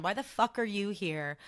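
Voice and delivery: usual speaking voice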